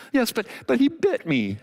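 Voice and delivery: high, stammering mock whimper; whimpering lion. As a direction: protest like a hurt crybaby. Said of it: "and he's whimpering".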